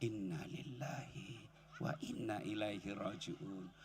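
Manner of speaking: low, slow and hushed; almost breathe it; soft slow recitation. Shown as a demonstration. Preacher showing wives the dhikr, slow and hushed.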